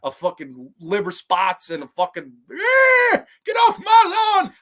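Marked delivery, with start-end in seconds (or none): 2.5-4.5 put-on high, loud cranky old-man shout; grumpy old man yell. Imagining himself old, yelling at kids like a grumpy old man.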